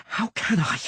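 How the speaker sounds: high, angry strained snarl; furious snarl. A narrator's reading. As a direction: snap back in fury. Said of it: "a furious, muffled snarl".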